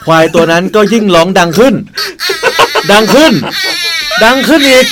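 rising to a loud, high roar; building to a roar. Pretend. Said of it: "ขอเสียงควายหน่อยครับ".

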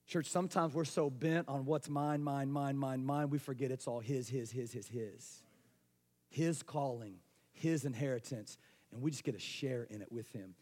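softly preached closing, low and quiet; hushed pulpit appeal. Acted. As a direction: draw them in close, almost confiding.

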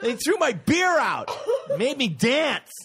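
high, loud laughing rant; comic griping, cracking up; laughing complaint. Real, not acted. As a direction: laugh through the mock outrage. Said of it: "and I was mad".

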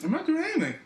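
high-pitched indignant protest; protesting innocence. Real, not acted. Co-host blamed for interrupting the story, high indignant protest. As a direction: plead innocence, pitch shooting up.

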